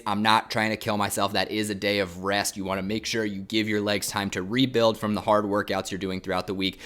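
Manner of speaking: ordinary talk, nothing marked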